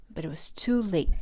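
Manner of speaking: ordinary delivery, nothing marked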